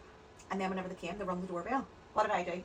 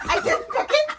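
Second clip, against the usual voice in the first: laughing; 16 dB louder, slower than usual. Real, not acted. Repeats, laughing.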